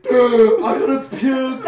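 loud, high, drawn-out cry; retold drunken wail. Drunk woman waking the house, mock loud wail.

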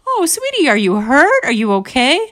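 high, cooing sympathy; sympathetic. Acted. Mom to the bug she pities, high sympathetic cooing. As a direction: fuss over a poor little thing. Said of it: "sympathetic to this bug".

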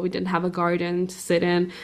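ordinary delivery

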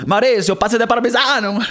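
high, hammed-up Italian accent; mock Italian accent. Mocking how the film has Tuscans speak, hammed-up Italian accent. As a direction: exaggerate the Italian accent through laughter. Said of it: "English but with Italian accent".

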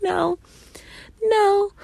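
very high, rising loud refusal; mock firm no; defiant no. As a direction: refuse her flat out. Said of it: "I was like".

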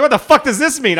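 put-on high, loud baffled old man voice; confused old vote counter. Imitating an old vote counter in Idaho, high and loudly baffled. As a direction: play the puzzled ballot counter. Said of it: "He's like".

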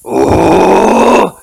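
loud, high orc exclamation; hammed-up orc outburst; orc grunt. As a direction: blurt it like a dim orc. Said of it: "and I go".

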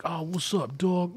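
high, softly tossed-off gangster greeting; lightly put-on street gangster voice; gangster talk. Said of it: "talk like you're a fucking gangster".

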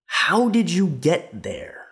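"how did you get there" high and slowly drawn out; mock pompous question. Mocking the host's question, slow and high. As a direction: ham up the question as the smug host. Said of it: "He goes, he goes".

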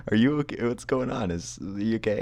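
high, loud mock-worried dad; concerned dad. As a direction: imitate a baffled father checking on his child. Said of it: "My dad's like".

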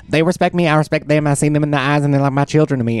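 high-pitched mimicry of a tiger keeper; Joe Exotic. Host as zoo owner describing his tigers, high-pitched impression. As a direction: lift the voice into his proud claim. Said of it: "he's like".

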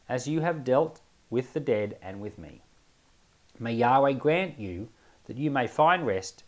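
usual speaking voice

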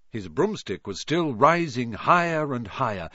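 ordinary delivery

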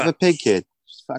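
put-on hammy Boston "Peppa Pig kid"; Boston accent with a loud "kid". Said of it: "Boston accent".

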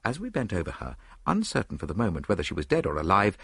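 plain reading voice

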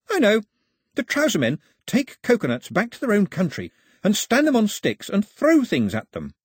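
high, animated explainer; lively Pilou. Acted. Pilou explains strange customs to Mao, high and eager. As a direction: explain it eagerly, pitched well up.

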